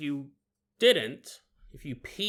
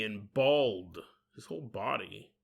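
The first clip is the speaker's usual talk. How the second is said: ordinary talk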